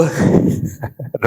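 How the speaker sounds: soft, breath-only laugh; short hushed laugh at an inside joke; low chuckle. Real, not acted. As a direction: chuckle quietly to yourself. Laughs at own hinted joke.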